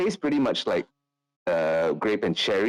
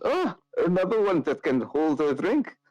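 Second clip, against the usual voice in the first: put-on higher, admiring drunk tone; pleased someone keeps up; impressed drinker; 9 semitones higher than usual. GM voices Summer.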